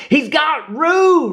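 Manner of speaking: very high, loud, slow panicked shout; frantic scream. Acted. Rabbit sees Roo with a Heffalump, frantic scream. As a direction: scream the alarm at full force. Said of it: "Rabbit screamed frantically".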